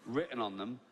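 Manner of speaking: usual speaking voice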